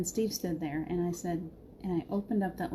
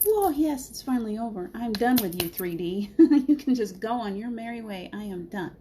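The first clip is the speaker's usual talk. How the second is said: higher and louder, whooping with relief; jubilant; 4 semitones higher, 6 dB louder than usual. Retold or imitated. Viewers holding their divorce papers, loud joyful cheer. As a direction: cheer like it's finally over. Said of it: "You're like".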